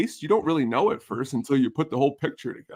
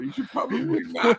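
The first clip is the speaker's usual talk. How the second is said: laughing imitation; 9 semitones higher than usual. Imitating wife, breaking into laughter.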